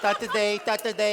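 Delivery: "तत दे" with t for k; mocking lisp. Suitor mocking lisping daughter, exaggerated "तत दे". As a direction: mimic the lisp on "तत दे". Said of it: "जितनी तू तत गई, तत गई कहला है".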